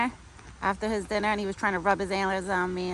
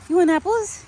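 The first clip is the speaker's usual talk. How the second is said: much higher, loud singsong call; 12 semitones higher, 10 dB louder than usual. Real, not acted. Calling her deer to the apples, high and loud. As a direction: pitch the call way up to get his attention.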